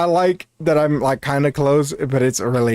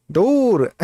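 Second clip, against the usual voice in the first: higher, drawn-out streamer impression; imitated streamer cadence; 6 semitones higher than usual. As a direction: lightly mimic the streamer's cadence. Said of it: "he kinda sounds like our, our mutual".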